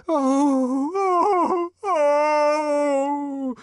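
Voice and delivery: high ecstatic "oh" wails; three high, rising moans of rapture; wordless testifying cries; inarticulate cries. A narrator's reading. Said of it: "Joanna inarticulately testified".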